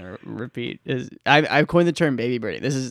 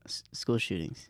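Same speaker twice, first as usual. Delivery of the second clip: whispered topic; 8 semitones lower, 13 dB quieter than usual. Real, not acted. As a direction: whisper it like a secret. Naming a touchy topic.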